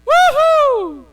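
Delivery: high, loud woohoo cheer; joyful whoop. Real, not acted. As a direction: whoop like a happy crowd. Preacher cheers. (masayang hiyaw na woohoo)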